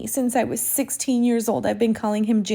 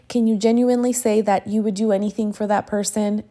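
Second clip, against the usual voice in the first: usual speaking voice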